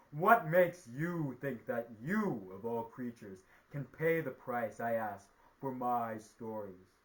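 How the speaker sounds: loud, drawn-out opening, mighty and mocking; scornful sky god. A narrator's reading. Sky god scoffing at the spider's request, loud scorn. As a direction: challenge them like a powerful god. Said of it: "he laughed at him".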